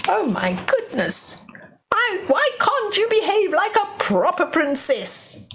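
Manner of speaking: exasperated king, pitch jumping high; lightly voiced angry father; king scolding. King scolds Charlie for throwing mud, exasperated. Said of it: "says the king".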